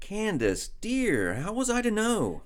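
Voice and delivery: high-pitched, placating plea; helpless, high protest; pleading excuse. Acted. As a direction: plead innocence to an angry wife. Husband excuses himself to angry wife.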